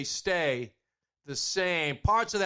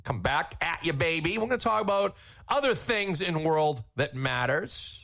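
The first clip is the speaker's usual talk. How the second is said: ordinary talk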